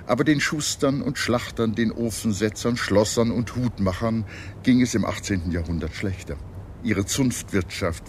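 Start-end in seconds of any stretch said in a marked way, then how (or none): none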